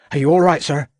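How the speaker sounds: worried constable checking on his boss; a constable's high concerned call. A narrator's reading.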